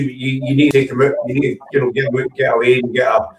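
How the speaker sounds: ordinary talk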